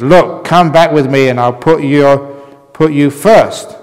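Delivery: ordinary talk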